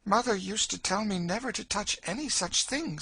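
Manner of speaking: put-on high, earnest girl's voice; innocent Emmeline. Girl recalls mother's warning on drink, high and earnest. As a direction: protest innocently, pitched well up.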